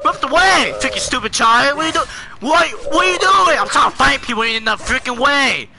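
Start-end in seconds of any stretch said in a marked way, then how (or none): 0.0-3.6 high, exasperated shouting; angry yelling. Real, not acted. Player angry at child in way.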